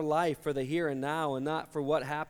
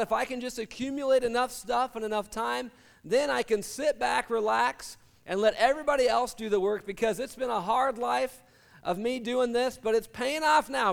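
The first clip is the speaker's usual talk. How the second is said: higher, self-satisfied brag; smug bragging; 6 semitones higher than usual. Acted. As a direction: gloat about finally coasting. Voicing the rich man's attitude.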